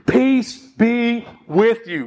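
slow, gentle peace be with you; slow tender blessing. Acted. Preacher giving Jesus' blessing to the congregation, slow and tender.

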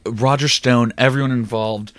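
ordinary delivery

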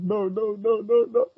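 hammed-up helpless string of protests; mock despair. Retelling Doctor Strange losing Christine, in high mock despair.

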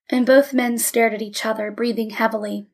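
usual speaking voice

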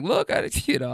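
words broken by a laugh. Real, not acted. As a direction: chuckle through the words. Laughing while excusing himself.

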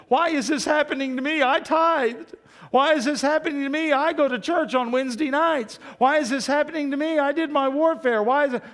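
high, loud self-pitying whine; mock the churchgoer who complains to God; whining believer. Preacher mimics a complaining believer, whiny and high. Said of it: "your first thing is".

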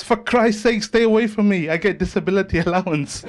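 high, loud panicked protest; hammed-up terror for a punchline; mock panic. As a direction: back away in acted alarm, breaking into a laugh. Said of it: "a look of complete panic on his face".

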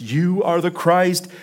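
rising, ringing confession of faith; bold, high proclamation; eloquent declaration. Acted. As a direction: proclaim it with conviction, pitch climbing. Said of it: "so eloquently declared to Jesus".

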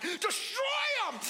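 very high, loud, drawn-out yell; hammed-up slow sideline bellow; sideline shout. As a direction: roar it like a fired-up sports dad. Dad yelling from sideline.

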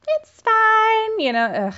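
very high, drawn-out mock breeziness; Catherine brushing it off. Catherine excusing rudeness to her husband, high and falsely breezy. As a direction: squeal it out with exaggerated nonchalance. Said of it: "but she was like".

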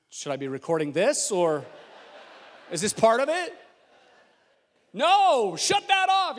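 a mock confused helper, rising to a loud high snap; a hint of a flustered couple yelling at the camera helper; bewildered bystander. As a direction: play the awkward onlooker, then bark shut it off. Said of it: "Ashley is over there like".

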